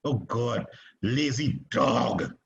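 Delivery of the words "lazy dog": "dog" drawn out very slowly; stress on dog. Retold or imitated. Teacher imitates student's accent, stretching out "dog". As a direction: linger heavily on "dog". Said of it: "the way she says dog".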